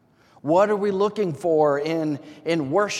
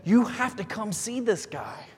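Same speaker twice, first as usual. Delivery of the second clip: mildly excited, higher-pitched retold quote; 6 semitones higher than usual. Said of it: "Andrew gets so excited about this".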